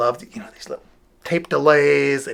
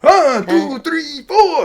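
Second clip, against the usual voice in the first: high, louder comic count-off; silly count-in voice; 13 semitones higher, 5 dB louder, much slower than usual. Shown as a demonstration. Teacher finding a new way to count in, high silly voice. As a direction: count the band in with a goofy voice. Demonstrating different ways to say it.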